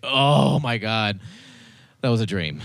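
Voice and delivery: relieved gasp. Retold or imitated. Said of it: "And then I wake up like".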